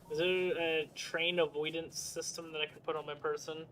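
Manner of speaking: ordinary talk, nothing marked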